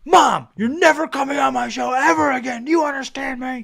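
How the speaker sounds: high-pitched shouted scolding; hammed-up son laying down the law to Mom; mock angry son. Imagined son to mom after the show, mock angry scolding.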